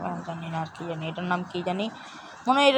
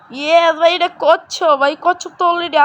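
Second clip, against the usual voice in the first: excited; 5 semitones higher, 7 dB louder than usual. Real, not acted. Finds a turtle in game.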